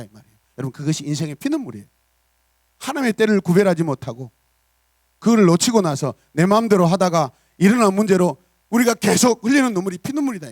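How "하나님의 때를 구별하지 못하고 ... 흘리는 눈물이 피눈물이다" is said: loud, high, urgent preaching; forceful preaching. Acted. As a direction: drive the warning home to the church.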